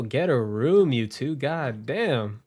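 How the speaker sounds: ordinary talk, nothing marked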